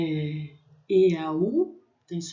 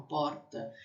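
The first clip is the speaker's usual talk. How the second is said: softer "porta" with a São Paulo R; São Paulo accent; 3 semitones lower, 8 dB quieter than usual. Shown as a demonstration. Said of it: "em São Paulo".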